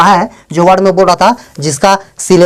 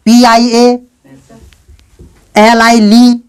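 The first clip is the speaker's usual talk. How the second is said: ordinary talk, nothing marked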